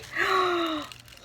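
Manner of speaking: sharp high gasp of delight; excited gasp. Real, not acted. Vlogger spotting the shakers, delighted gasp. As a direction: gasp at the surprise.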